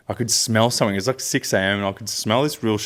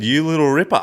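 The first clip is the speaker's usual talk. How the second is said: retold Aussie cheer; 7 semitones higher, 3 dB louder than usual. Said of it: "I said".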